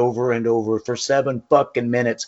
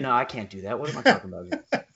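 usual speaking voice

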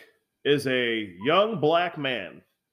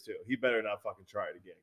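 ordinary delivery

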